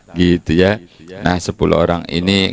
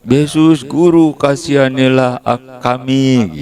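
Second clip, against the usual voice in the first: lepers crying out; 7 semitones higher than usual. Retold or imitated. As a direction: call out to Jesus for mercy. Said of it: "Teriak-teriak".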